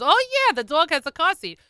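high, loud swoop on "oh, yeah"; emphatic insistence. Retold or imitated. Woman defending the dog's car seat, emphatic insistence. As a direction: confirm it as utterly obvious.

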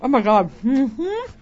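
talking while chewing; mouth full. Real, not acted. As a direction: speak through a mouthful of food. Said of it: "Can you hear me chewing?".

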